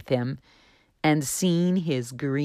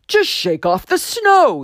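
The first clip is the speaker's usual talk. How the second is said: higher, louder gentle urging; voice on the wind; 10 semitones higher, 7 dB louder than usual. Acted. Voice on the wind urging Juniper to shake off snow. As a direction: coax him kindly from the wind. The voice continued.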